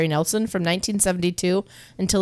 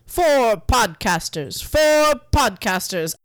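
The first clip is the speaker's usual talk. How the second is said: Count von Count voice; 3 semitones lower than usual. Acted. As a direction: count them out like the Count. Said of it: "discounted by the count".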